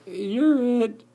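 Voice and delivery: high, loud taunt that slows into a laugh; hammed-up playful spider; teasing garden spider. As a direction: dare them to chase you.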